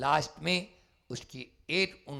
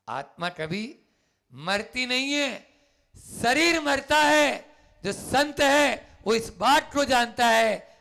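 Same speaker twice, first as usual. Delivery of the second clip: fervent preaching; 10 semitones higher, 10 dB louder than usual. Acted. Preacher proclaiming the soul never dies, booming. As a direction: build to a booming declaration.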